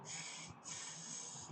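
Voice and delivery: long thin hissed s; s sound demonstration. Shown as a demonstration. Teacher showing pupils the seen sound, hissed s. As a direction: sustain the bare s with no voice. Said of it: "like that, okay".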